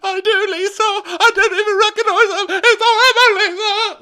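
very high, loud mock sobbing wail; [name]. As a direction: imitate a hysterical crying fit at full pitch. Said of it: "immediately starts crying".

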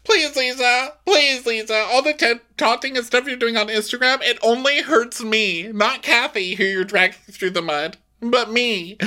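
high, whiny mock plea; mocking Kyle. Host voicing Kyle begging Lisa in a high whine.

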